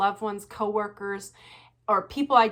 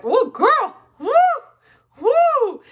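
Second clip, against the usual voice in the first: much higher, louder mock-flirty whoop; hammed-up flirt; 8 dB louder, slower than usual. Nicholas flirting with Chantel, mock whooping. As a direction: hoot it like a cheesy flirting man. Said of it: "He's like".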